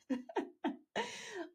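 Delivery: short high giggle; embarrassed laugh. Real, not acted. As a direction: laugh at your own slip. Laughs at her own faux pas.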